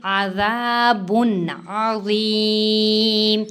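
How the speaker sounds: अज़ीम with a full thick ज़ drawn slowly; heavy zwa, slow. Shown as a demonstration. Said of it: "ज़ुआ मोटा और पुर पड़ा जाएगा". (अज़ीम में मोटा ज़ुआ)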